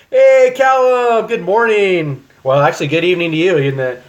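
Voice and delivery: loud, high mock Australian accent. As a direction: greet him like a cheery Aussie bloke. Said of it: "Okay, that was Australian".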